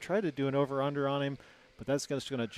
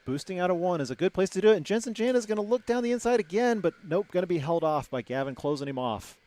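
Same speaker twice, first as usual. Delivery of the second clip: higher, louder excited call; excited race call; 6 semitones higher, 6 dB louder than usual. Real, not acted. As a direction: ramp up for the pass. Attempted pass, live call.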